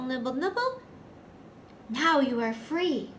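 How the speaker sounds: ordinary talk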